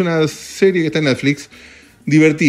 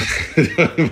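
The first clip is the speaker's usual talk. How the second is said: short laugh; 4 semitones higher than usual. Real, not acted. Laughs at own bad review.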